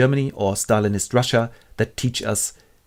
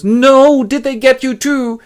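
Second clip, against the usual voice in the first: much higher, louder horrified voice; shocked Smith; 8 dB louder, slower than usual. Acted. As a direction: gasp in dismay. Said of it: "and Smith goes".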